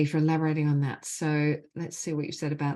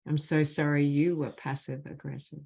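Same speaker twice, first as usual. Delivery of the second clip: measured, deliberate leading of a phrase; guided healing phrases; 2 semitones higher than usual. Acted. Facilitator gives the group apology phrases to repeat, measured and deliberate. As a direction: lead the group line by line, leaving space after each.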